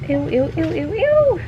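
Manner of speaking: high squeaky ew ew; disgusted ew. Real, not acted. Cleaning poop-stained clothes.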